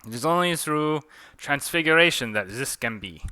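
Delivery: put-on mild French-accented pronouncement; theatrical French accent. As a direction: declare it in mock French accent. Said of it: "Forgive my French accent".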